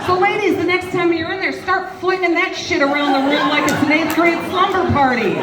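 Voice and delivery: high, excited call to the ladies; rallying cry. Real, not acted. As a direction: rouse them like a party starter.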